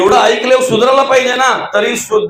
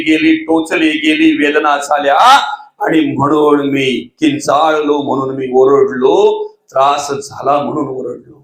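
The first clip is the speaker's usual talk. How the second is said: pained voice; 6 semitones lower than usual. Acted. Pierced man tells his pain.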